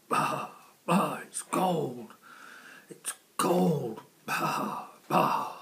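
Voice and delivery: loud bleating goat voice. Acted.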